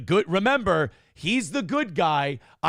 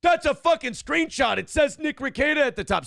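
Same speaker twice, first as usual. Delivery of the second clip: exasperated high-pitched outburst; 10 semitones higher than usual. Retold or imitated. Exasperated reply, much higher.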